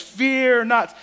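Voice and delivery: booming angel voice. Acted. Gigantic angel speaking to the shepherds, booming. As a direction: boom it out like a giant angel. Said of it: "big booming voice".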